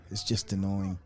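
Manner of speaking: a weary sigh, then a low grumble; annoyed sighing mutter. Retold or imitated. Fed up with prank videos, muttering to himself in annoyance. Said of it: "I'm like".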